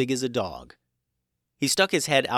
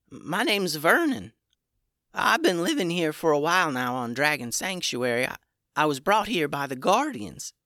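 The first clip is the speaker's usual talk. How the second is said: little dragon Vernon; 5 semitones higher than usual. A narrator's reading. Vernon the little dragon tells story.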